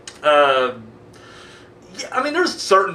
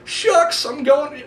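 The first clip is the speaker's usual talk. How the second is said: mock whiny priest; 8 semitones higher, much slower than usual. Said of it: "They're just like".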